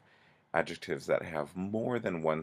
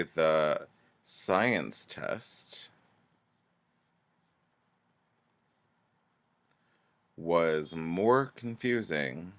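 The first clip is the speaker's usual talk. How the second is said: slow example sentence; much slower than usual. Shown as a demonstration. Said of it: "two sentence examples".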